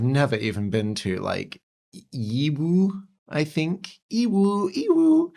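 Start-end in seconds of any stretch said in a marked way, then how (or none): 2.1-5.3 Yiwu said the English way, rising higher each time; cute English Yiwu. Shown as a demonstration. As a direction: play with Yiwu, sliding it up higher. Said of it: "Yiwu sounds very cute when you say it in English".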